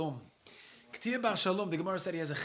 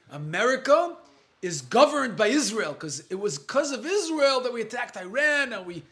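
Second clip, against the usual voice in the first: mock indignant complaint, raised pitch; mimicking accusers; 4 semitones higher, 6 dB louder than usual. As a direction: build to a louder mock outcry. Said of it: "Like they're saying".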